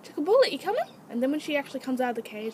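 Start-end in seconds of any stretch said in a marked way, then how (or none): 0.0-0.9 high, coaxing call to an animal; raised pet-calling voice. Real, not acted. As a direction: coax your pet out with a bright-pitched call. Calling guinea pig out of cage.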